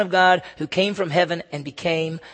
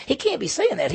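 higher-pitched incredulous protest; scoffing objection, pitched up; disbelief; 8 semitones higher than usual. Acted. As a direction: voice the crowd refusing to believe it. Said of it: "They're actually missing it. They're, they're saying".

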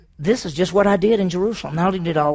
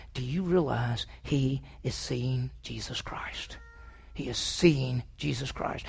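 slow, lower, whispered wonder; awed whisper; 6 semitones lower, 11 dB quieter, slower than usual. Real, not acted. Preacher marvels to the church in a slow whisper. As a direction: breathe it out in awe.

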